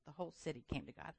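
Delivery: soft, quick repeat of the line; quiet echo. Real, not acted. Preacher repeating the point, softly. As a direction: drop it to a hushed aside.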